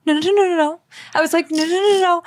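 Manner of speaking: high, rapid string of no's in mock panic; panicked protest. Retelling her stage panic to her co-host, high frantic no's. Panicking as she gets called up.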